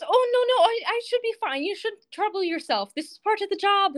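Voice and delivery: high, fast polite woman's voice; flustered high-pitched politeness; innkeeper woman voice. Acted. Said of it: "She says".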